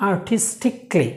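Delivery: আর্টিস্টিকলি as ar-tis-tik-lee, no "kaa"; four-syllable আর্টিস্টিকলি with silent A; correct pronunciation. Shown as a demonstration. Teacher answering what the right pronunciation is, আর্টিস্টিকলি with "tik-lee". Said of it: "সঠিক উচ্চারণটা কি?".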